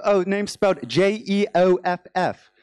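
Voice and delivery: American's hammed-up British accent; fake British accent. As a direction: spell the name carefully in a put-on British accent. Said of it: "with a fake British accent".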